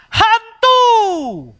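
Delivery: high, loud crying shriek of ghost; terrified cry. Acted. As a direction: scream as if seeing a ghost. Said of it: "akan kita bilang". (teriakan ketakutan)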